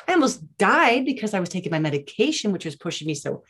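high-pitched theatrical announcement with halting pauses; dramatic teenage retelling. Retold or imitated. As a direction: imitate a girl milking her big news. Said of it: "just be all dramatic".

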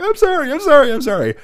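very high, squeaky mock apology; tiny high voice, repeating it frantically; pleading apology. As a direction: imitate a scared kid begging forgiveness. Scolded child pleading in retold scene.

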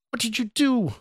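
very high, strained plea; anguished cry. A narrator's reading. Scott searches shattered mirror for stranger, desperate cry. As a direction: wail it far up in pitch, without added volume. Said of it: "Scott cried".

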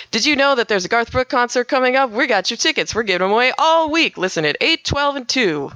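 high, energetic promo read. Shown as a demonstration. DJ promoting concert tickets to Orlando listeners, energetic announcer voice. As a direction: hype the giveaway like a country DJ. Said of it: "but it's much louder".

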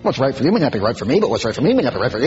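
fast, tossed-off parody; rattled off mockingly. Preacher parroting the relativist slogan, fast and mocking. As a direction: rattle it off dismissively.

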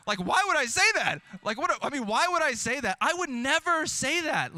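high, loud self-mocking disbelief; mock outrage. Real, not acted. Pastor mocking his own words to his wife, high and loud. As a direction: scold yourself in high disbelief. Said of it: "mock outrage at himself".

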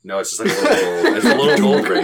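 high, loud laughing through words. Real, not acted. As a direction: laugh while you explain. Laughing while explaining.

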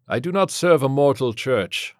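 loud, steadfast priest; loud declaration. A narrator's reading. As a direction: declare it loudly to the demon. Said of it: "the padre said loudly".

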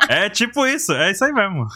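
laughing while talking. Real, not acted. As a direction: say it through a laugh.

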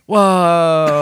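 high, loud stretched whoa; mock whoa. Crowd gasping at the assembly, hammed-up whoa. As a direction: gasp it out in fake awe.

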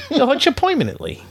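high cartoon rabbit voice; squeaky old-timey Brooklyn cartoon voice; Bugs Bunny impression. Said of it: "It's Bugs Bunny".

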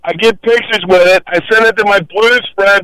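normal talking voice

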